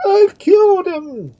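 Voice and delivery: very high, loud, long cry; piercing shriek. A narrator's reading. Duke repeating his confession, piercing shriek. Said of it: "repeated the Duke with still more piercing shriek".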